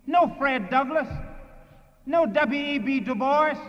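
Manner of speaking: normal talking voice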